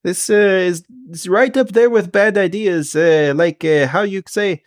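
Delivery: high, searching character voice; Kent, doubtful helper. Acted. Kent objects to the bad idea, high and hesitant. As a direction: grope for the phrase in a high voice.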